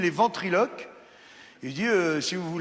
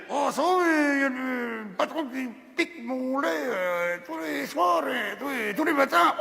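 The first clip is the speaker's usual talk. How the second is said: high, strained animal voice; high put-on cow voice; 7 semitones higher than usual. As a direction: squeeze out the cow's complaint. Cow answers.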